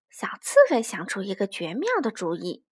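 ordinary delivery

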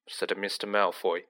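ordinary delivery

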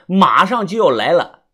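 plain reading voice

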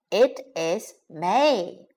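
slow "It is May" with gaps between words; slow teaching model of "It is May". Shown as a demonstration. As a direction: separate each word of "It is May" for learners. Teacher models phrase word by word.

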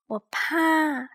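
high, small timid cub voice; tiny scared bear cub; Little Bear voice. A narrator's reading. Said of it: "小小熊说。".

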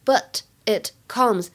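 "but it comes" word by word, with gaps; separate words. Shown as a demonstration. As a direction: say "but", "it", "comes" slowly and apart. Gives the three words one by one. (“but it comes”逐词分开慢读)

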